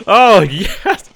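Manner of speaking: very high, loud triumphant yells and laughter; ecstatic cheering. Real, not acted. Gamer celebrating a successful landing, ecstatic shouting. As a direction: cheer like your dream just came true. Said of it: "I'm feeling quite emotional now".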